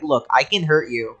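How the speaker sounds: normal talking voice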